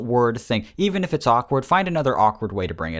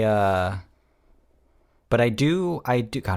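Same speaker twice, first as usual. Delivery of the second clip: ordinary talk, nothing marked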